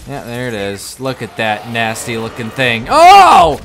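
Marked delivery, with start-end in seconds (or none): 2.9-3.6 loud, high cry of surprise; startled yell. Real, not acted. Player reacting to a nasty looking thing, startled yell. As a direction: yelp out in sudden alarm. Jumped by enemy in game.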